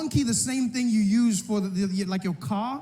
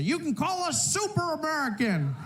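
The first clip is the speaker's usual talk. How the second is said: retold southern shout; 7 semitones higher than usual. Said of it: "a gentleman shouted out".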